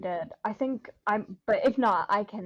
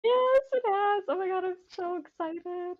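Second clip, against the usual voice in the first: much higher, put-on gushing accent voice; excited character voice; 10 semitones higher than usual. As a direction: squeal it like an overjoyed character. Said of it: "I love your accents".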